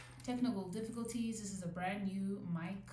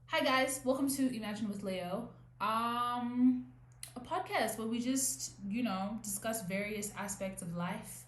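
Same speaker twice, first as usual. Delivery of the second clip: bright, louder host greeting; podcast host intro; 3 semitones higher, 4 dB louder than usual. Shown as a demonstration. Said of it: "No, I cannot start it like that".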